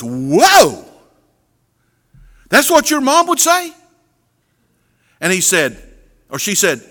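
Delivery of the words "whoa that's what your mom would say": put-on rising, amazed whoa from the older coworker; surprised coworker. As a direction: play the coworker's startled disbelief. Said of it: "that guy goes".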